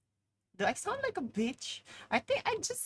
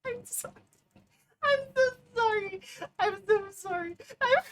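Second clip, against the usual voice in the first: very high, louder sing-song sorry; squeaky apology; 13 semitones higher, 5 dB louder than usual. Real, not acted. Squeaky mock apology while laughing.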